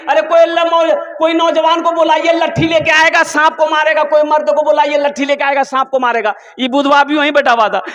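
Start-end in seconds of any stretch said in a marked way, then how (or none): 0.1-6.3 mock frightened women calling. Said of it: "तो औरतें चिल्लाने लगी".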